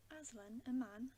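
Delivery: mildly stern, punching the last words. A narrator's reading. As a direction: narrator voicing a stern rebuke. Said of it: "said Mister Beaver sternly".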